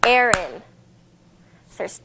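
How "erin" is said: Erin split into two clapped beats, E-rin; clapped syllables. Shown as a demonstration.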